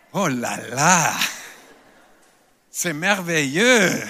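hammed-up French "oh la la", drawn out slowly and low; mock French delight. Preacher jokingly swoons in French about heaven, hammed-up.

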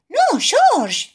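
put-on high, loud worried little girl; a high, fretful no to her little brother; high-pitched child scolding; worried Peppa. A narrator's reading. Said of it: "dice Peppa preocupada".

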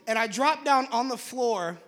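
usual speaking voice

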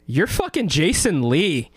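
slow, stunned and starstruck; awed exclamation. Retold or imitated. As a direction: say it slowly, stunned by who's there. Retelling meeting celebrity at window.

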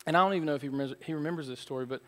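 ordinary talk, nothing marked

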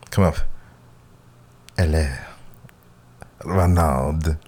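put-on lightly accented seductive purr; a hint of a vampire, slow and low; Sofia Vergara impression. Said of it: "I don't know why I sound like Sofia Vergara now".

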